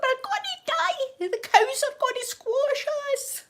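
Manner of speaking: high, slow hammed-up squeal of terror; mock panic wail. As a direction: wail it like the end is near. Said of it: "I was like".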